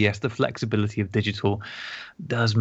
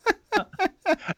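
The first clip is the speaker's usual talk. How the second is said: laughing. Real, not acted. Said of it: "Was it like he was in the room".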